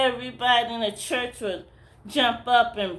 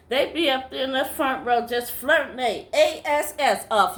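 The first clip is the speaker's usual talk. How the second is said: ordinary delivery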